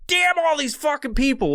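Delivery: high, exasperated mock curse; mock fed-up groan. Fed-up worker cursing the customers, high hammed-up exasperation. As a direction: play the worker who's had it. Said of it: "you're like".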